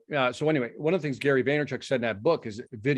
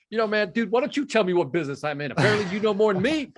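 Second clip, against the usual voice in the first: irritated retort; 8 semitones higher than usual. Retold or imitated. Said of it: "And I'm getting irritated".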